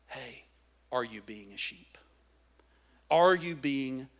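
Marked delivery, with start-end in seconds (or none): 0.1-2.0 very soft, low question; hushed, low aside to the listeners; quiet challenge. Real, not acted. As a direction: ask it gently, almost under your breath. Gentle challenge, then repeated louder.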